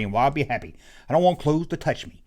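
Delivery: ordinary talk, nothing marked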